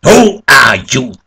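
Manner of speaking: loud, high startled demand; sharp exclamation. A narrator's reading. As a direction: exclaim it as you recoil. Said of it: "withdrew sharply and exclaimed".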